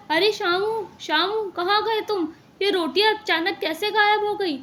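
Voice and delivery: high-pitched, puzzled summons; acted bewildered call; alarmed calling out. Owner calls servant over vanished roti.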